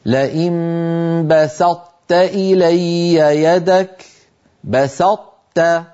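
very slow "بسطت" with an unreleased emphatic ط then a light ت; careful recitation of "بسطت" keeping the ط emphasis; correct demo. Shown as a demonstration. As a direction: draw out "بسطت", the ط held flat and merged into a thin ت. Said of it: "فأطبقت لساني على طاء غير مقلقلة".

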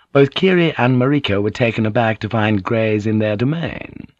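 plain reading voice

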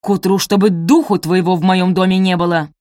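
loud, forceful banishment; furious. A narrator's reading. Mistress throws maid out of her house, furious. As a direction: drive her out with each word. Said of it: "яростно прошипела Араминта".

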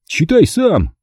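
high-pitched huffy command; offended. A narrator's reading. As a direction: throw it at him in a huff. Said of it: "обиделся Джерри".

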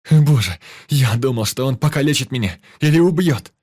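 high, loud shaken outburst; breathless frightened shout. A narrator's reading. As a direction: still panicking, shouting. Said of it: "с одышкой кричал Ваня".

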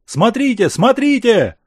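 high, loud excited calls; excited shouting. Acted. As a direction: call out, pointing eagerly. Crowd points at arriving ships.

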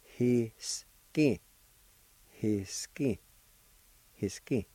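"ye, li, i, ki, da" each syllable apart, then joined. Shown as a demonstration. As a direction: teach the syllables slowly and clearly. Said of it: "It's pronounced like this".

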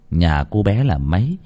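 ordinary delivery

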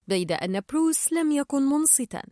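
ordinary delivery, nothing marked

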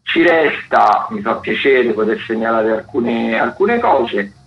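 ordinary talk, nothing marked

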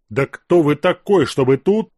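loud, hammed-up indignant shout; theatrical outburst. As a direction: blow up for show. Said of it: "Театрально завёлся Азеф".

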